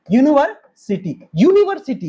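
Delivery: यूनिवर्सिटी said with a clear "yu" at the start; stress the yu sound. Shown as a demonstration. Teacher showing the consonant yu sound in यूनिवर्सिटी. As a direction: lean on the opening "yu" of यूनिवर्सिटी. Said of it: "देखो, यूनिवर्सिटी है ना".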